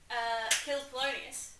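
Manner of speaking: normal talking voice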